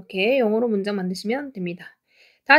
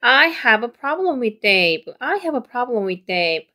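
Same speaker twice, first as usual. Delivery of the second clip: slow model reading; much slower than usual. Shown as a demonstration. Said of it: "전체적으로".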